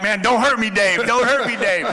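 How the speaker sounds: laughing plea not to be dropped; mock panic. Player begging teammate Dave not to hurt him. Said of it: "I'm like".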